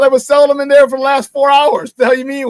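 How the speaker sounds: loud, high, incredulous retelling; a friendly but fed-up loud protest to a clueless customer; retold exasperated outburst. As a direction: replay your disbelief at full volume.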